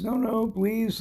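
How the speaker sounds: high-pitched mock pleading; retold pleading. Girl begs attacker in retold scene.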